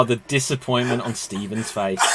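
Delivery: ordinary talk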